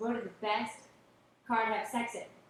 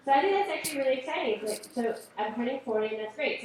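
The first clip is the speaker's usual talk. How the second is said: ordinary talk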